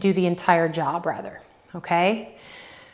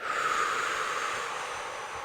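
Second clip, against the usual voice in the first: breathless whispered sigh; 12 dB quieter than usual. Real, not acted. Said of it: "even I'm out of breath right now".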